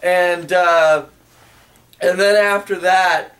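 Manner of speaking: usual speaking voice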